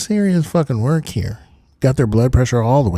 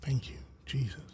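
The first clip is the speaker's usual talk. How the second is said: whispered relief; 15 dB quieter than usual. Real, not acted. Relief as stream comes back.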